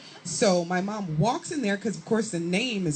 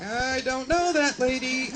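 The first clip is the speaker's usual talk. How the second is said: higher, slow, drawn-out disowning; mock-innocent denial; 5 semitones higher than usual. Girl disowning her mother before classmates, mock-innocent denial. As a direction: play the kid pretending ignorance.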